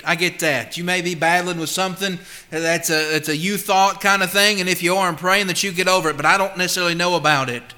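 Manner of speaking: normal talking voice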